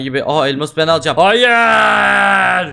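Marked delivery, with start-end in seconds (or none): 1.2-1.5 loud protest. Real, not acted. Losing the diamond in game. 1.5-2.7 long shouted no; drawn-out shout. Real, not acted. Losing the diamond in game.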